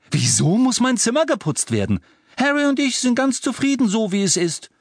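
hoch und lauter, trotziger Sohn; wütend. Acted. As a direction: Ron protestiert wütend. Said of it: "sah seine Mutter wütend an". (Ron protestiert bei seiner Mutter, wütend)

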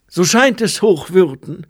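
high, loud monk's reply; monk answering the abbot. A narrator's reading. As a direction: answer up to your superior, pitched high.

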